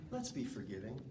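gently asking, voice dropped low and soft; a soft plea to forgive; softly. Shown as a demonstration.

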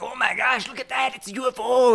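high, hammed-up Mexican accent; a cartoonish Mexican accent impression; bad Mexican accent. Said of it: "your Mexican voice again 'cause it was that bad".

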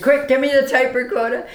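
high, loud urgent demand; urgent call. Retold or imitated. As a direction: snap it out in a hurry. Urgent request in taxi, retold.

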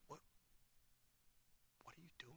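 voiceless whisper, a private question. Retold or imitated. Father asks son privately.

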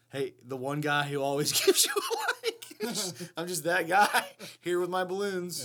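laughing through the words; giggly reading. Real, not acted.

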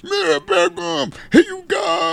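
slow, very high goofy wail; mocking dim-witted vampire. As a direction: draw it out in an exaggerated mock cry.

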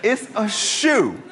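slow, drawn-out deadpan restatement; a slow, incredulous hammering of "It's a shoe"; slow emphatic repeat. Real, not acted. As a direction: spell out "It's a shoe" slowly, each word weighed. Repeats the punchline slowly.

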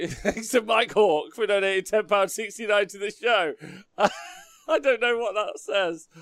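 high, loud, through real laughter; giggly and high-pitched; laughing read-aloud. Real, not acted. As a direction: read it out trying not to laugh. Reading a rude joke name.

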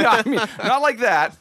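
high, loud laughing denial; laughing protest. Real, not acted. To the hosts, laughing off the dirty reading. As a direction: laugh it off, no no not like that.

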